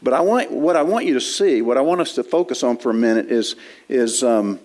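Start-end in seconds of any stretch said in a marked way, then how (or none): none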